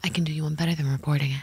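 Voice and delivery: quick conspiratorial whisper; hushed and fast, like sharing a secret. Acted. Secret bribe offer to classmates.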